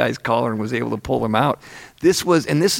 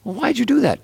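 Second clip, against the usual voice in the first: much higher, disbelieving retold question; incredulous questioning; 12 semitones higher than usual. Questioning the heckler outside after the show, incredulous. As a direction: act out a high-pitched, baffled challenge.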